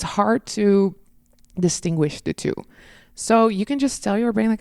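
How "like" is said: ordinary delivery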